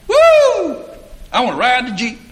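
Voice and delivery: high, loud mock kid whoop; excited boy. Kyle asks to ride the Jeep, excited high shout.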